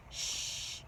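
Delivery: soft unvoiced hush; whispered shh. A narrator's reading. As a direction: hush her gently. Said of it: "the other furries whispered".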